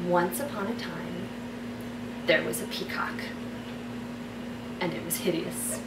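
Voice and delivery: soft, unhurried fairy-tale reading with long pauses; story narration. Acted. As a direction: read it like a bedtime story. Reads her fairy tale aloud.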